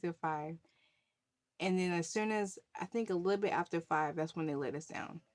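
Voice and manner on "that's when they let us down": ordinary talk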